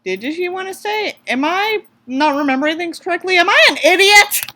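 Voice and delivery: usual speaking voice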